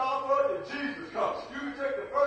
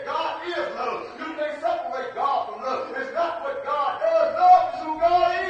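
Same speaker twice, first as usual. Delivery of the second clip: preaching at full force; 4 dB louder than usual. Acted.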